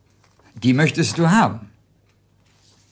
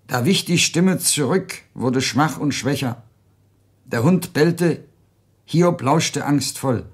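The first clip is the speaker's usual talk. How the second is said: usual speaking voice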